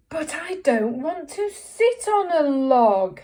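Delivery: slow, loud, high drawn-out whine; whiny frog. Acted. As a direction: moan it out long and reluctantly. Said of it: "in a whiny voice".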